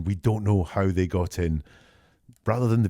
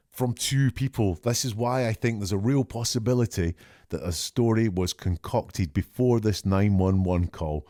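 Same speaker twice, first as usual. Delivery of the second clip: ordinary delivery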